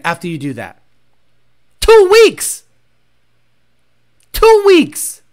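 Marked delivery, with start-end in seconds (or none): 1.8-2.6 much higher, loud blurted answer; emphatic answer. Real, not acted. Answering his own gym question, loud and high. 4.3-5.2 much higher, loud blurted answer; emphatic answer. Real, not acted. Answering his own gym question, loud and high.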